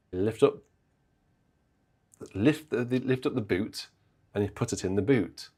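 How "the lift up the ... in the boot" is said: ordinary talk, nothing marked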